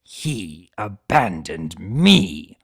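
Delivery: slow, low, bitter villain voice; Tirek voice. Acted. Tirek refusing Discord's point, slow and low bitter growl. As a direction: drag it out low like a sulking old tyrant.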